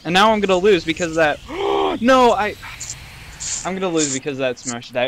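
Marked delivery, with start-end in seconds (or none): 1.4-1.9 sharp high gasp. Real, not acted. Sharp gasp at a near loss.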